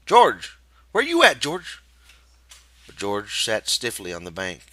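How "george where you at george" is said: loud, higher call across the brush; shout. A narrator's reading. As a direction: call out for a lost friend. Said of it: "Slim's voice shouted".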